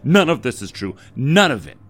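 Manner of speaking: loud, hammered denial on none; furious denial. A narrator's reading. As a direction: slam each none down hard. Debbie refuses the story.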